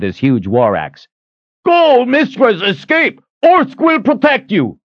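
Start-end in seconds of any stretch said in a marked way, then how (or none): 1.6-4.8 high, urgent battle cry; bellowed. A narrator's reading. One-eyed man urging his mistress to flee, bellowed. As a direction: shout her away to safety. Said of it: "The one-eyed man bellowed".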